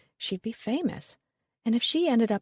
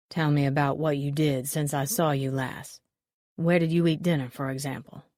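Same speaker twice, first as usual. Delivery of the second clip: stern detective; 4 semitones lower than usual. A narrator's reading. Detective questions Molly.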